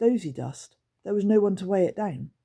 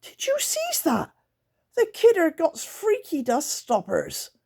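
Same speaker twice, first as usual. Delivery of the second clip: slow, much higher, panicky creature voice; 12 semitones higher, 4 dB louder than usual. Acted. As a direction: panic at the goggles. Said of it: "Guff mumbled in panic".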